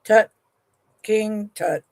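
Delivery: ordinary delivery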